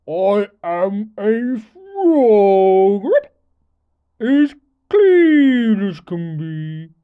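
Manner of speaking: slow, loud croaky frog voice; croaking frog. Acted. Dripping frog asking for a ride, slow croak. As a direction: croak each word out slowly. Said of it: "then said with a croak".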